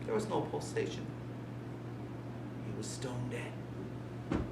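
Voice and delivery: breathy, toneless whisper; eerie whisper. A narrator's reading. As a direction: hush it as if the room might hear. Checking the corpse.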